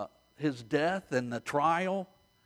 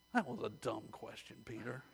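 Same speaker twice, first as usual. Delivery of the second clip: much softer, lower aside; quiet teasing aside; 6 semitones lower, 14 dB quieter than usual. Pretend. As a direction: drop the voice way down, as if confiding in Peter. Teasing aside to Peter after quote.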